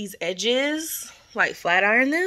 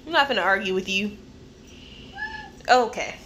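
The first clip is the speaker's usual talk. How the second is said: normal talking voice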